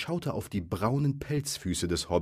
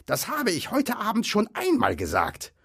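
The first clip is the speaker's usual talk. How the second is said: high, irritated troll squawk; indignant troll; 14 semitones higher, 4 dB louder than usual. A narrator's reading. Bert repeating himself to Bill, indignant. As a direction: insist in a high, cross voice.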